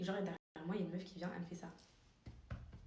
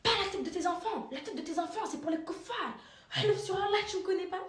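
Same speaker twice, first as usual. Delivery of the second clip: much higher and louder caricature of the aggressor; aggressive woman's voice; 10 semitones higher, 5 dB louder than usual. Retold or imitated. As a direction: screech it like the furious stranger. (voix de l'agresseuse, très aiguë et forte)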